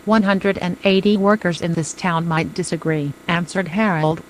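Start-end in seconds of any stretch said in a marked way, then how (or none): none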